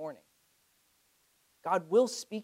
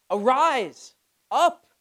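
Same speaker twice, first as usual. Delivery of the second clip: high, loud shouted call to rise; urgent command; 5 semitones higher, 7 dB louder, much slower than usual. Retold or imitated. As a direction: shout it to rouse someone now. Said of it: "He says".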